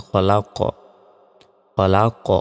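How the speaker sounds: "খলা ক" with a pushed, echoing final q; qalqalah bounce. Shown as a demonstration. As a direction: bounce the last consonant of "খলা ক". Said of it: "শেষে ধাক্কা দিয়ে পড়তে হবে".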